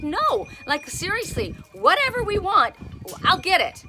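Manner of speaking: high and loud, pushing hard; retold excited pleading to Uncle Phil; eager insistence. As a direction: insist you'll get them anything. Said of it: "And I'm like".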